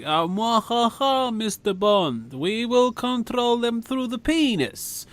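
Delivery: slow, high hammed-up evil villain; sneering panto villain. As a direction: gloat like a Bond villain. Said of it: "Bond villain Twisted style scientist".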